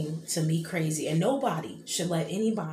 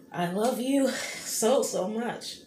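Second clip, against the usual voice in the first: tearing up; 6 semitones higher, 4 dB louder, slower than usual. Real, not acted. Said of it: "sorry, you guys, about the, you know, tearing up".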